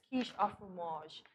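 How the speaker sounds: "Quiche au fromage" in slow, soft French; French pronunciation. Shown as a demonstration. Co-host shows off French learned from a cartoon, French pronunciation of "Quiche au fromage". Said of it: "guess how I know how to pronounce that".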